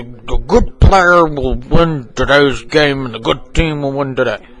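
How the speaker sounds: ordinary delivery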